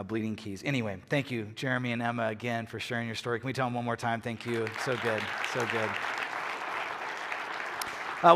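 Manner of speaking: ordinary talk, nothing marked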